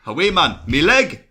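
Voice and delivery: high, loud Geordie catchphrase; Geordie accent. Acted. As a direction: throw yourself into broad Geordie. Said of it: "usually with Geordie you'd be like".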